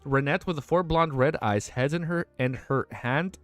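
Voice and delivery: steady, even read-aloud narration; audiobook-style reading; story narration. A narrator's reading. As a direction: read the novel to chat.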